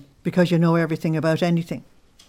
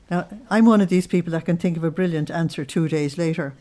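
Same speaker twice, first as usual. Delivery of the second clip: ordinary talk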